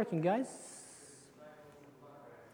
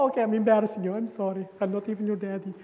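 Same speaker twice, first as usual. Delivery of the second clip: normal talking voice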